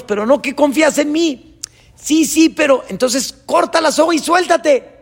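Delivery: high, loud argument; insistent shouting. Acted. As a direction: press the point at full voice. Acted quarrel of God and climber.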